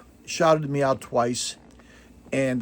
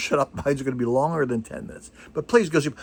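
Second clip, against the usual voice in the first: ordinary talk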